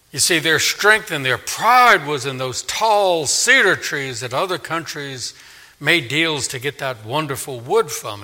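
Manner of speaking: usual speaking voice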